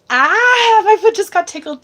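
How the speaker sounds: loud, very high yelp; real jolt of being tickled, squealed out; startled squeal. Real, not acted. Medium tickled mid-reading, startled squeal.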